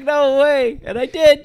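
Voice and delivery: high, loud hype shout; proud, pumped-up cry; excited yell. Retold or imitated. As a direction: yell it like you just nailed the squat. Hyped retelling of big squat.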